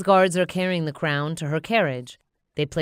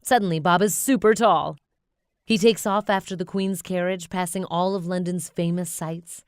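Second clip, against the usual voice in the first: ordinary delivery, nothing marked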